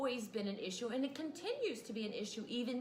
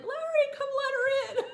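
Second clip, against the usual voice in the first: much higher and louder, laughing; frantic call; 9 dB louder than usual. Retold or imitated. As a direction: yell for Larry to come help. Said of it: "I was like".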